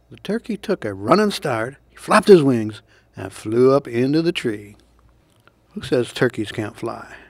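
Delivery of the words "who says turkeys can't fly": ordinary delivery, nothing marked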